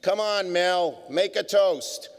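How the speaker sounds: guests urging. Retold or imitated. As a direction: pitch it up and nudge him like the table of guests. Guests urging Mel again.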